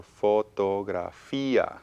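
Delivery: "fotografía" slowly in separate syllables, fo-to-gra-FI-a. Shown as a demonstration. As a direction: stretch out each syllable of "fotografía". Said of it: "It has five syllables".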